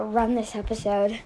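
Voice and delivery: normal talking voice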